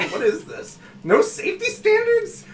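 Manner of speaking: high, loud incredulous outburst; mock indignation. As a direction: squawk it in comic outrage at the shoddy workmanship. Acting indignant at old ship systems.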